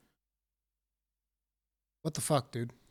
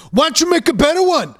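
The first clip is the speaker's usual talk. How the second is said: higher, louder, fast snippy retort; a chef snapping at a customer over bad food; snooty chef; 6 semitones higher, 5 dB louder, faster than usual. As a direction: toss it back like an offended chef. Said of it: "the chef comes out and he's like".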